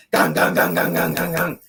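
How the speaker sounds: deep loud call at the door; mock gang call. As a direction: bang on the door and call out low. Calling out through the hotel door.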